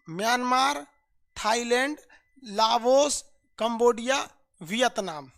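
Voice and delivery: one country at a time, pausing after each; slow roll call with rising list tune; confident, measured listing. Shown as a demonstration. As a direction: recite the list deliberately, leaving gaps between names. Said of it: "एकदम full confidence के साथ".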